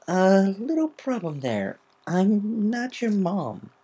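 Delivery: slow, high, nervous chuckle voice; awkward. Acted. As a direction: let her down awkwardly. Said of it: "chuckled nervously".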